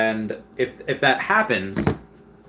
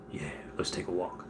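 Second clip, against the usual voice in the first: very soft, lower, cowed agreement; meek reply; 5 semitones lower, 15 dB quieter than usual. Retold or imitated. Sergeant giving in to security, meek and quiet. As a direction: shrink and go along quietly.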